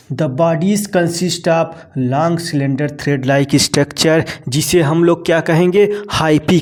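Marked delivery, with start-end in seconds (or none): 0.1-4.2 very slow, word by word reading; spelling out a textbook definition at dictation pace. Shown as a demonstration. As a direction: read it out slowly so students can note it. Teacher reads textbook line aloud.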